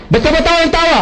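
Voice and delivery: high, exasperated question; indignant demand. Retold or imitated. The jilted man scolding the laughing stranger, indignant and high. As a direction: demand an answer, fed up.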